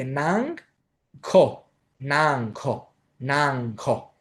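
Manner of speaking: ΝΑΝΓΚ with nasal ng, then separate aspirated ΚΟ; two separate sounds. Shown as a demonstration. Teacher separating two different sounds, syllable by syllable. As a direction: break it cleanly, ΝΑΝΓΚ then ΚΟ. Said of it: "Πρέπει δηλαδή αυτό να το πείτε".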